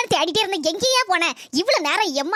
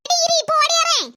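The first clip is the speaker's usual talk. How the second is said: calling out; 8 semitones higher, 4 dB louder than usual. Acted. Calling neighbour to wait.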